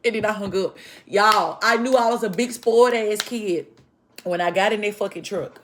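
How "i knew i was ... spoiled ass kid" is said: normal talking voice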